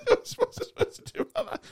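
very high, laughing through the words; laughing mock quote. Retelling a fireman's shrug at a burning tree, laughing high. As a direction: squeal it out while laughing. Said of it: "he just looks at it and went".

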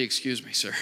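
preacher mimics a fussy passenger, deadpan; imitating the lady. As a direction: sigh it out as mock courtesy. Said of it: "Always with the".

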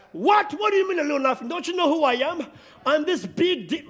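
indignant outburst, first word shouted high; hammed-up offended demon, voice jumping up on the first word; a proud demon insulted at being called a little nothing, indignant.

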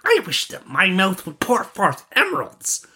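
put-on very high boyish character voice; excited young friend. Friend trying the charm himself, high and excited. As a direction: pitch it right up, wishing aloud.

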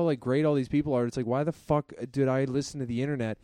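ordinary delivery

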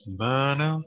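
drawn-out imitation, pitch gliding up; imitating a sound. Cohost mimics how the clip keeps sounding, imitating. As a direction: stretch it out with a rising end. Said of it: "He keeps sounding like a".

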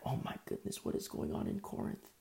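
near-whispered dismay, very low; hushed shock. Acted. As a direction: voice drops away in stunned disbelief. Acted hushed shock at the news.

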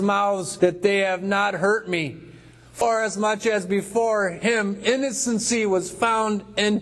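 high, slow call from below; voice from the cavern. Acted. Daniel protesting his innocence to the king, high cavern call. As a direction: draw it out high, calling up from the den. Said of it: "from way down there echoing in the cavern".

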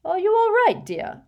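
put-on fussy, gushing rich old lady, laughing in; a vain old witch showing off to a charming boy; giggly hostess voice. Hepzibah's smile falters.

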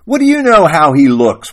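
loud, high acted wail; moaned. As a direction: moan the question in exasperation. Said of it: "Parker moaned".

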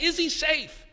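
put-on high, anxious child voices; worried children asking about the lion; nervous children. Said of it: "they're like".

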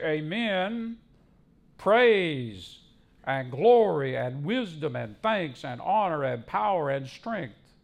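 emphatic list of praise; scripture declamation. Acted. Preacher declaims heavenly praise.

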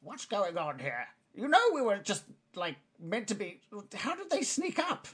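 high, puzzled goblin voice; second goblin. Acted. Goblin finding strangers have sneaked up, high puzzled voice. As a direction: pitch jumping up in goblin confusion.

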